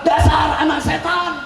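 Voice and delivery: mother's angry yell. Retold or imitated. Preacher imitating a mother scolding her child, angry yell. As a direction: yell it like a mother who has lost her patience. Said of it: "habis sabar".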